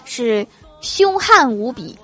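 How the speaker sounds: slow, deliberate correction; 慢慢纠正. Retold or imitated. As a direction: spell it out slowly, teasing. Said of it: "郭大侠摇头说". (郭大侠摇头慢慢说)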